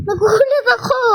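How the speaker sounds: real startled shout. Real, not acted. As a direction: blurt it out after the scare. Startled by sudden scare.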